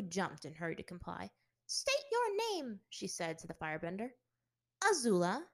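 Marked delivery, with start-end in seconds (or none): 1.7-2.8 stern teacher. Acted. Strict teacher questions newcomer. 4.8-5.5 said simply. Acted. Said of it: "she said simply".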